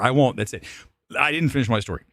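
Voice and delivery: ordinary talk, nothing marked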